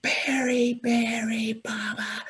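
high, loud calling out a name from below; retold desperate shout. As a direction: yell his name down the mountain in panic. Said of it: "Steve is shouting from below".

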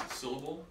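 Syllable with the accent on SYL; SYL-la-ble, front-stressed; stress on first syllable. Shown as a demonstration. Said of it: "I moved the accent from each".

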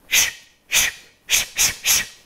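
steady whispered shh pulses; rhythmic shushing. Shown as a demonstration. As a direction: shush in an even beat. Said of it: "Sounds like this".

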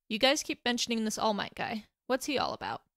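plain reading voice

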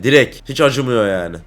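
normal talking voice

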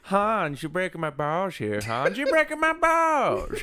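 mock the Team America accent, drawn out; fake Team America accent. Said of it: "they throw R's into everything".

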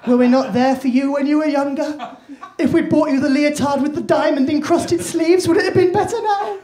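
high, loud, pleading mum; desperate bargaining. Retold or imitated. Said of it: "the bargaining what-if stage".

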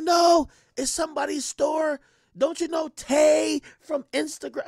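high, slow, shouted insistence; girlfriend shouting. Retold or imitated. Girlfriend insisting about Tay's store, slow and shouted. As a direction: yell it like it's obvious. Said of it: "She's like".

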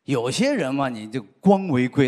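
ordinary talk

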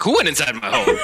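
high, loud, laughing disbelief; shocked question to the old lady; incredulous. Retold or imitated. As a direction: blurt it out half laughing. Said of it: "I was like".

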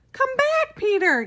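put-on high, loud child's yell; a boy yelling after his sister and goat. A narrator's reading. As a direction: holler the goat's name after it. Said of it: "yelled Mendy".

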